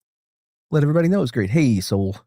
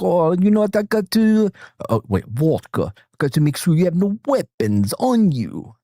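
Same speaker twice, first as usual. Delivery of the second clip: higher-pitched mock Russian accent; 6 semitones higher than usual. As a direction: put on a playful Russian accent. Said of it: "Your Russian accent was".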